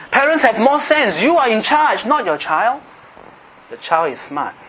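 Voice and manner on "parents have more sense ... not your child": high-pitched, forceful sermon delivery; driving pulpit challenge in a raised pitch; impassioned preaching. Real, not acted. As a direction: preach it hard, pitched well up.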